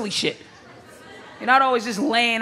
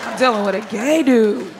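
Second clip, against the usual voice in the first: ordinary talk, nothing marked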